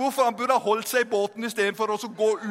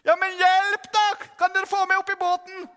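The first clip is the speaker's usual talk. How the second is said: desperate plea; 8 semitones higher, 4 dB louder than usual. Acted. Sinking Peter begs brothers to haul him up, acted desperate shout. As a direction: plead urgently at a raised pitch.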